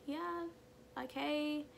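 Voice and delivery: mock aloof reply; distant. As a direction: answer without any interest. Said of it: "I was really distant".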